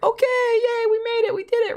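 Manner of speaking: high squeaky mock celebration; sarcastic yay in a cartoonish high pitch; mock cheer. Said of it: "and I'm like".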